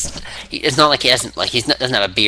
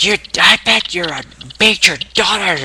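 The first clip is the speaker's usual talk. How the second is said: high-pitched mock taunt; imitated accusation; 8 semitones higher than usual. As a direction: hurl a put-on accusation in a raised voice. Acting Punk's taunt of Austin.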